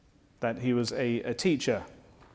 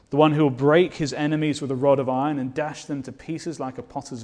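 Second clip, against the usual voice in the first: preaching at full force; 2 semitones higher, 6 dB louder than usual. Acted. Preacher to congregation extolling Christ, rising to full force. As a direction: build it to a climax, word by word. Builds to climactic praise.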